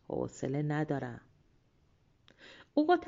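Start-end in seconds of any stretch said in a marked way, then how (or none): 0.1-1.2 low, soft, can't-be-bothered reply; unwilling refusal. A narrator's reading. Lazy Yemlia refuses the officer.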